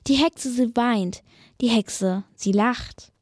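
plain reading voice

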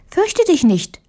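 put-on high-pitched mouse soothing a frightened girl; high, kindly mouse voice; reassuring little mouse. A narrator's reading. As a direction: pipe it up reassuringly.

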